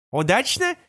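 navigator voice from a speaker; gps voice. Acted. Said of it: "спросил голос навигатора из настенного динамика".

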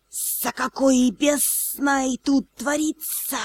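slow, soft snake hiss; serpent hiss. Acted. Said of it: "прошипела эта самая змея".

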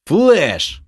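high, loud warning cry; shouted warning. A narrator's reading. Mage warns his party of the flash, shouting. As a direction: yell the spell name out to warn everyone. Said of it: "не забыв выкрикнуть предупреждение".

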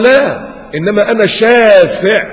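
high, loud, slow insistent answer; emphatic reply. Retold or imitated. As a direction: press the point gently but firmly. Prophet's reply voiced emphatically.

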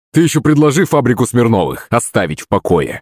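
ordinary delivery

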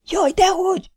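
high, shrill-pitched squeal of alarm. Acted. Vi squeals in alarm to stop Kinizsi. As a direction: shriek it up high. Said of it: "sikkantott fel Vi".

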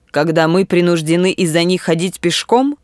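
plain reading voice

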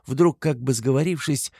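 usual speaking voice

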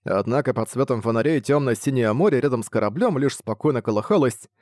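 ordinary delivery

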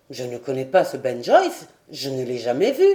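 low-voiced, baffled seaman; puzzled old sailor. A narrator's reading. Sailor answering his lord about Ben Joyce, puzzled. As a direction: pitch it low, plainly denying.

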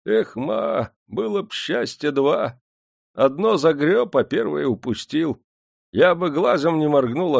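put-on high-pitched, lamenting old man; disappointed old worker. A narrator's reading. Old worker regrets his friend's failure, ruefully. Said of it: "разочарованно покачал головой".

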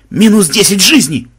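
loud, high shout; yelling. A narrator's reading. Banzai calling out lives lost, loud yell. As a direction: bellow out the damage. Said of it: "заорал Банзай".

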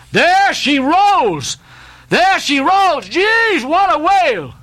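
high, slow, drawn-out sighting yell; excited shout. Acted. Tenerife man sighting the whale, shouting out high and long. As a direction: bellow it across the deck at the whale. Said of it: "all at once shouted out".

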